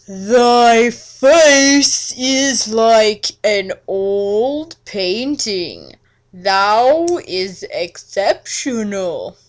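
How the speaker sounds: slow, drawn-out mock-archaic vampire suitor; hammed-up old-world charmer, dragging every word; Edward's courtly voice. Edward's voice again.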